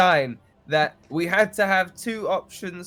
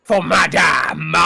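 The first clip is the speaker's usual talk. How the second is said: mock-grand waiter; 8 dB louder, much slower than usual. Brick serving with mock ceremony.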